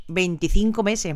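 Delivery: normal talking voice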